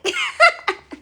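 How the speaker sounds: high-pitched real laugh; laughter. Real, not acted. Speaker cracking up at her own silly voice, high laugh.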